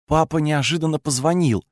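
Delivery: usual speaking voice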